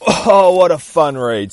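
delighted exclaim. Real, not acted. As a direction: savor the fun. Reacts after finishing the comic.

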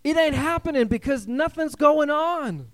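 high, loud exasperated complaint; frustrated believer. Retold or imitated. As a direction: whine at God that nothing happens. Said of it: "We're going".

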